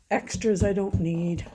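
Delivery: ordinary talk, nothing marked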